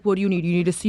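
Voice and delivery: put-on light New York City accent as dad; lightly imitating dad in a New York accent. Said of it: "this is my New York City accent".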